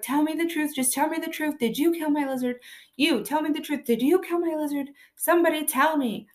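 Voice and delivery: put-on distraught child. Daughter demanding answers about lizard.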